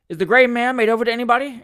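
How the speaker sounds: anxious inquiry. A narrator's reading. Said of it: "inquired Mister Weller anxiously".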